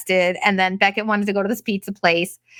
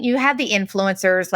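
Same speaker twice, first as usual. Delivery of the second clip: usual speaking voice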